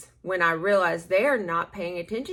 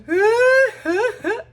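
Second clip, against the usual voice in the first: laugh; 14 semitones higher, 12 dB louder than usual. Real, not acted. Laughing about ugly cry.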